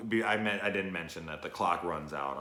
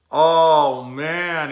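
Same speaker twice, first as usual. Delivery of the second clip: real emotional cry, much louder and higher; loud groan; 9 semitones higher, 19 dB louder than usual. Real, not acted. Vlogger crushed by the ending, loud cry. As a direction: let the scene hit you out loud.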